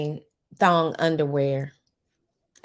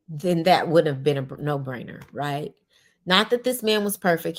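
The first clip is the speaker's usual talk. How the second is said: ordinary delivery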